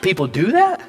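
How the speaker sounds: surprised. Retold or imitated. Retold disbelief at home birth.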